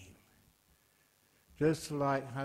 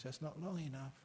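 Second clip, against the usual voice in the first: normal talking voice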